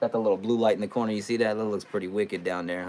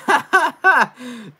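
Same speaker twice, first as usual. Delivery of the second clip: high, loud burst of laughter; loud cackle; 9 dB louder than usual. Real, not acted. Streamer laughing hard at a Jaguar fan's take. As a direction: cackle at the rival fan's comment.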